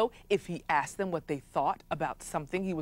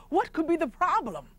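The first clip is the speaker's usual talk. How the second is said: courtiers fussing; 10 semitones higher than usual. Acted. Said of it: "they said".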